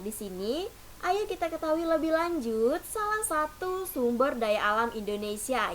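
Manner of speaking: ordinary talk, nothing marked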